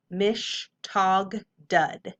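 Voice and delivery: mish, tog, dud as separate beats; syllable by syllable. Shown as a demonstration. Teacher sounding out the word for students, syllable by syllable. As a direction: give MISH, TOG and DUD each its own beat. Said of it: "Whole word".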